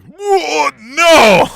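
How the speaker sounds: high, loud outcry of disbelief; mock shocked shout. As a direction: yell it like you can't believe what you just saw. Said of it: "seeing it made me be like".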